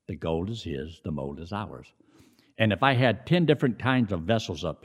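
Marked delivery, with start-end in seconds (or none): 0.1-1.9 softer, lower restatement; The gold is his, the mold is ours said softly and low; quiet repeat. Shown as a demonstration.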